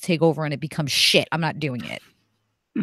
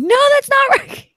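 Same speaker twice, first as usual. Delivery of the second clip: outraged high yell; 8 dB louder than usual. Retold or imitated. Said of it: "I'm like".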